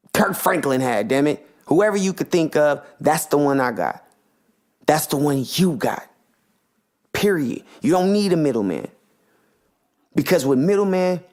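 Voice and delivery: usual speaking voice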